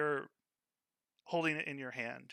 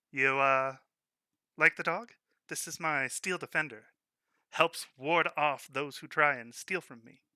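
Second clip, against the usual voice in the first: proud shopkeeper; 3 semitones higher, 7 dB louder than usual. Acted. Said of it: "Malco looks at you and he goes".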